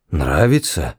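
low, blank echo; dull-witted. A narrator's reading. Guard baffled by Ford's question, dull and low. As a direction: repeat it without comprehension. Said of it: "тупо повторил он".

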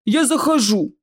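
a hint of booming troll; rolling bass. Troll outside the door announces itself, rolling bass. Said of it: "послышался раскатистый бас из-за двери".

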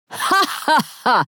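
slow, high, fake ha-ha-ha; fake laughter. A narrator's reading. Brother pretending the joke is funny, fake laugh. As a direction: laugh in a blatantly forced way. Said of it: "абсолютно ненатурально захохотал Том".

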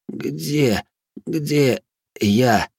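weak, halting, strained voice; dazed captive. A narrator's reading. Revived captive asks his captor, dazed and halting. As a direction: struggle out each word, disoriented.

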